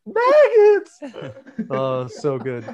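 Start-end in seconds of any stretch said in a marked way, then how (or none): 0.1-1.0 high, loud yell that breaks; voice cracking on a yell. Retold or imitated. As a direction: scream the word and let the voice crack. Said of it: "his voice cracked".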